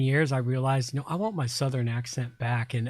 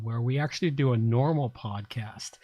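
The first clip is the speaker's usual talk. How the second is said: normal talking voice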